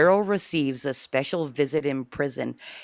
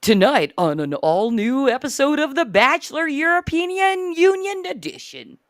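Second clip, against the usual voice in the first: TV announcer; 10 semitones higher, 8 dB louder than usual. Acted. TV show announcer intro.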